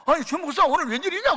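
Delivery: startled. Retold or imitated. Mentor stunned to see Choi arrive for dinner. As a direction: sound truly shocked he's here. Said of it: "또 놀래".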